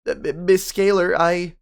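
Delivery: high, loud nervous stammer; frightened boy stuttering before the principal. Acted. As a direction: trip over the words in panic.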